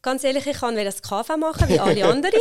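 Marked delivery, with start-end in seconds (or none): 1.7-2.4 laughing it off, louder; breaking into a laugh at herself; sheepish laugh at her own plans. Real, not acted. Admits wanting KV like everyone.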